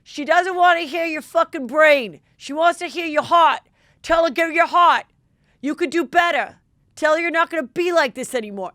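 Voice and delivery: loud, high mock New Jersey coach yelling; New Jersey accent. Coach on the side yelling at the boyfriend, loud New Jersey accent. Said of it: "with a New Jersey accent being like".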